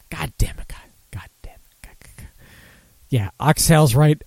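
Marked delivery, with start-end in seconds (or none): 0.1-2.3 soft frustrated whispered swearing; whispered cursing. Real, not acted. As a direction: hiss curses under your breath. Frustrated drunk muttering to himself.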